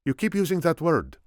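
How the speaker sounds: high-pitched snap in the Spaniard's voice; snapped retort. A narrator's reading. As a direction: snap back at the boss. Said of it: "the Spaniard snapped".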